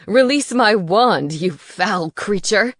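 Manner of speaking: loud, slow bellow; a furious wizard bellowing at an owl. A narrator's reading. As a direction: bellow the demand. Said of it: "he bellowed".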